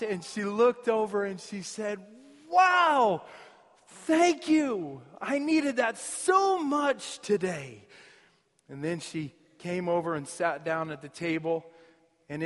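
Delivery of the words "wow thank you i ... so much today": higher, slow, moved thanks; a stranger deeply grateful; touched woman. Retold or imitated. Passing woman thanking the boy, moved and higher.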